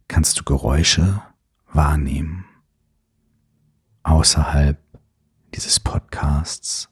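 calm, unhurried breathing cues; guided meditation. Acted.

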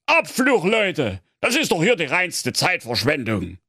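high, put-on complaining voice; grumbling character voice. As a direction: whine it like a fed-up courtier.

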